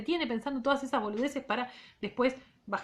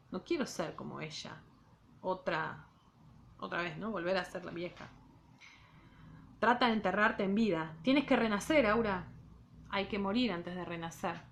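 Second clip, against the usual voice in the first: measured literary read-aloud; reading a novel aloud to students; story narration. A narrator's reading. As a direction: read the novel to the class, unhurried.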